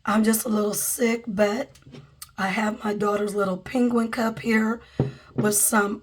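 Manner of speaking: usual speaking voice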